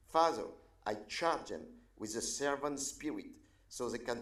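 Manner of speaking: earnest public prayer with sudden swells on the calls to God; invocation prayer. Acted. Pastor praying over county leaders, earnest prayer voice. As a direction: pray over the leaders, lifting each appeal.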